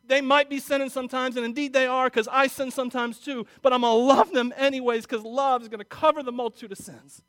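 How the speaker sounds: loud, high pulpit voice; full-force preaching. Acted. Pastor urging the church to love, preaching hard.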